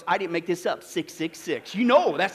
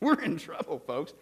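laughing; 6 semitones lower, 7 dB quieter than usual. Real, not acted. Laughing pastor aside to congregation.

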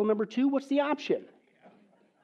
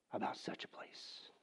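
whisper; 18 dB quieter than usual. Real, not acted. Trailing whisper.

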